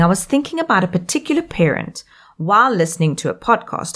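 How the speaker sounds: usual speaking voice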